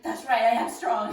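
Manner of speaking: mock pep talk. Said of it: "that little pep talk".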